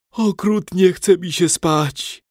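high, slow words through a yawn; drawn-out yawning complaint; long yawn. A narrator's reading. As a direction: stretch it out mid-yawn, loud and sleepy. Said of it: "ziewnął przeciągle".

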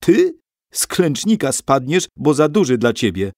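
high, loud scoffing taunt; mocking girl. A narrator's reading. Basia mocks Stasiek, high and loud. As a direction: laugh him down.

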